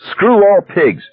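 very high, hysterical shout; hysterical yell. A narrator's reading. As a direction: shriek it like an enraged heckler. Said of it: "a hysterical voice yelled".

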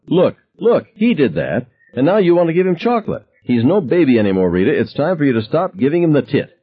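jab each point at her, furious; heated father. A narrator's reading. Father scolding his wife over the boy, high angry rant.

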